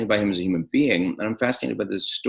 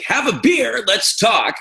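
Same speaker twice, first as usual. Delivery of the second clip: high, loud, hearty invitation; jovial bon vivant, pitched way up; uncle's welcoming voice; 12 semitones higher, 5 dB louder, slower than usual. Retold or imitated. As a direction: wave him into the party. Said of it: "he still got that, like".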